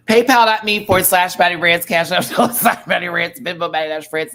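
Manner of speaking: loud, high donation shout-out; mock-urgent plug. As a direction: belt out the payment handles like an urgent appeal.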